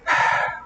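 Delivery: long voiceless exasperated sigh; acted deep breath out. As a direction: heave a deep sigh in character. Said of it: "she sighs deeply".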